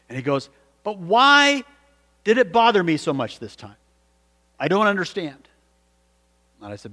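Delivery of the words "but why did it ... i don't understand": high, loud WHY, then easing; perplexed, pitched-up questioning; bewildered. Retold or imitated. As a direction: imitate a man baffled by his own conscience. Bewildered man questions pastor after gasp.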